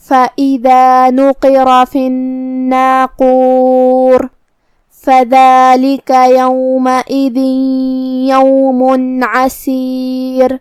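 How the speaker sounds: very slow chanted tilawat; Quran recitation. Acted. Teacher re-reciting the verses for her students, slow chant. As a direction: draw each word out in a measured chant.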